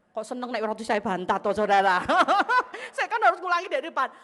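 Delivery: loud, amused, laughing protest; laughing tease. Real, not acted. Preacher teases congregation for enjoying her mishap, laughing. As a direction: tease the listeners through laughter.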